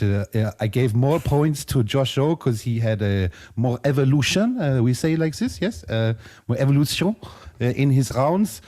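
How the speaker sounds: French judge Saro in a thick put-on French accent. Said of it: "I am Saro, I am one of the judges".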